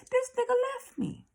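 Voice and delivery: squealed, incredulous complaint; high-pitched disbelief. Retold or imitated. Telling viewers her companion ran out on her, high-pitched disbelief. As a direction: voice shoots way up in comic outrage.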